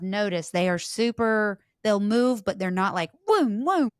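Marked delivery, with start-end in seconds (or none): 3.3-3.9 whooshing noise. Retold or imitated. Mimicking fast-moving dowsing rods.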